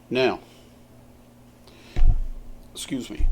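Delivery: speaking through heartburn; pausing for a heartburn burp. Real, not acted. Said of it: "a little heartburn there".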